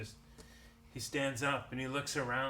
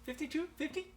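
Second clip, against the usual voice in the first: auctioneer. Acted. GM voices auctioneering apple seller.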